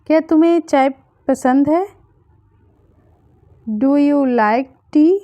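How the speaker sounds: ordinary delivery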